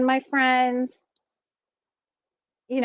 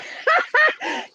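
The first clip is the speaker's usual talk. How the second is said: high laughing shout breaking the sentence; can't get the words out for laughing; burst of laughter. Real, not acted. Laughing mid-sentence at the message.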